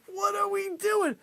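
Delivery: high, slow helpless wail; nearly crying. Real, not acted. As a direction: wail it, worn out by laughter. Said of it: "You're making me cry".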